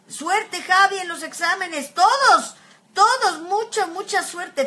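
loud, high cheer; loud, excited cheering to her followers. Real, not acted. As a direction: shout encouragement to everyone. Cheering everyone on for exams.

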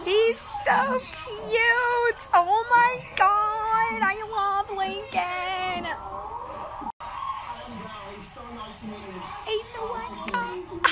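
very slow, high-pitched adoring squeal; swooning gush. Real, not acted. Viewer in love with Lincoln, in a high swooning whine. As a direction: melt into a high, drawn-out coo.

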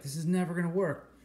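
high mock whine of despair in a put-on voice; despairing student. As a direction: whine it like a kid who gives up. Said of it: "And you're like".

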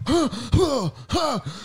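big theatrical gasp, high; mock kung fu gasp; sharp gasp. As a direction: suck the air in hard mid-move. Acting out wild kung fu moves.

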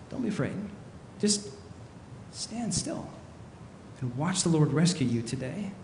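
calm a panicking crowd very quietly; hushed reassurance. Acted. Repeats Moses's calming words softly.

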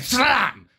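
sharp high shout of slam; mock slam yell, high and loud. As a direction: bark out the door slam. Imitating door slam noise.

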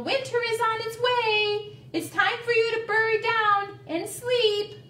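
high, sustained sing-out call. A narrator's reading. Turtle warning Woodchuck that winter is coming, calling out. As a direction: sing it out so it carries uphill. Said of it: "called Turtle".

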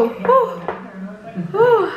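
surprised squeal. Real, not acted. Reacting to her reflection.

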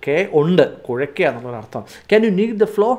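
normal talking voice

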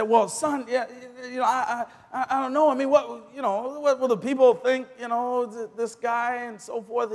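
high, loud, stammering reluctance; flustered mother. Retold or imitated. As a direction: imitate a mother fumbling for an excuse. Mother voiced, balking at son's guest.

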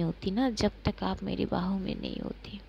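whispered into her ear; intimate whisper. Acted. Maysha whispers to Pankhuri in her arms. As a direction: breathe it softly while holding her.

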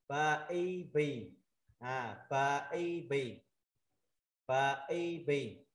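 "Pa I bì" very slow, each syllable held; syllable-by-syllable drill. Shown as a demonstration. Teacher showing students pa with mark Y, slow drill. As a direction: drill "Pa I bì" with long ee. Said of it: "mình đọc là".

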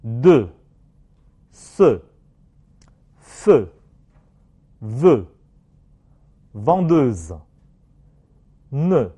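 de, ce, feu as separate French words with closed rounded eu; French word drill. Shown as a demonstration. Teacher has class repeat, de to courageux with closed eu. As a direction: model vendeuse and courageux with lips forward, small opening. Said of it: "放在单词里面".

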